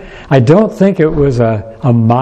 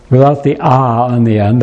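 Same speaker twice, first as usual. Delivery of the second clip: usual speaking voice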